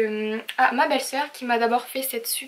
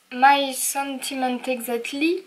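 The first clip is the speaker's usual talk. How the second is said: English read out with a French accent; French speaker attempting English stamp titles; slower than usual. Real, not acted. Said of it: "vous m'excuserez pour mon accent anglais".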